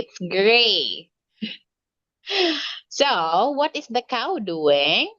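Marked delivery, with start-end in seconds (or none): 2.3-2.8 light laugh. Real, not acted. Teacher laughs at child.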